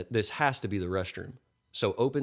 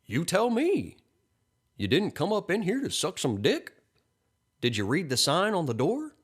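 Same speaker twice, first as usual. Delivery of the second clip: high-pitched, incredulous questioning; indignant retort; 9 semitones higher than usual. A narrator's reading. Man retorts in acted argument.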